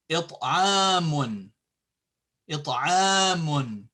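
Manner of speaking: it'amun with a long held 'a; it'amun, slow on "aam"; long vowel. Shown as a demonstration. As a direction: stretch the middle a of it'amun. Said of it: "not just ah".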